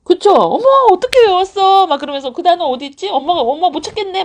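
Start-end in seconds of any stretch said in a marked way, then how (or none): 0.3-1.9 put-on high, loud marveling at a child; amazed mom demo. Shown as a demonstration. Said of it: "막 이렇게 하시면 되는데". 2.3-4.2 high-pitched pretend puzzlement for a child; mom playing clueless. Shown as a demonstration. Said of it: "막 이렇게 하시면 되는데".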